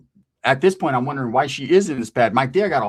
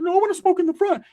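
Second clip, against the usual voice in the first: cousin Ricky voice. Retold or imitated. Cousin Ricky pulling him aside, in a high squeaky voice.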